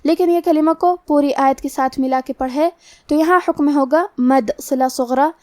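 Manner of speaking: usual speaking voice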